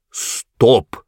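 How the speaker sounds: insistent order to stop; repeated command. A narrator's reading. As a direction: cut in to demand silence. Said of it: "повторил он".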